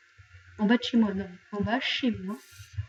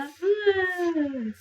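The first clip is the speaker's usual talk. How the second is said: mock sob; 7 semitones higher than usual. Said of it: "Tu sais, avoir ton pote".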